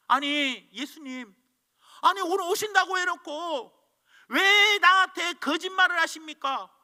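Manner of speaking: high and loud, slow, hurt complaint; indignant protest. Retold or imitated. The cobbler accuses Jesus of breaking his word, loud and slow. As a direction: demand an answer from Jesus.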